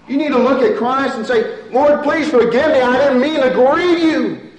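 loud, high, fervent preaching; preaching at full force. Acted. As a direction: drive the plea home to the congregation.

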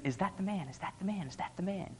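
soft little voice from far in the background; a small kid asking quietly off in the distance; distant child's voice. Retold or imitated. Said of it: "this little high-pitched voice".

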